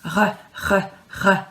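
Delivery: "R, r, r" rolled from the back of the throat; French R demo. Shown as a demonstration. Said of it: "the R sound is quite tricky".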